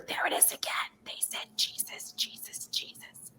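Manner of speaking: faint unvoiced whisper, fading away. Little daughter whispering in front of the church. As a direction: barely breathe the words. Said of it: "and she's whispering".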